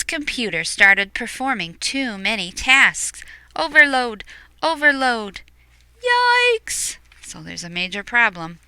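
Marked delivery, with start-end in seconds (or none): 3.5-5.4 higher, louder urgent alert; alarm warning. Acted. Computer alarm voice. 6.0-7.0 very high squeal of alarm; comic fright cry; panicked yikes. Acted. Panic exclamation.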